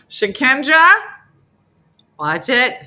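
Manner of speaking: loud, sharp call to Shakendra; calling out a teasing warning. Real, not acted. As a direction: warn her off playfully. Scolding a friend.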